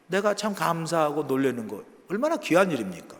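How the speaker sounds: ordinary talk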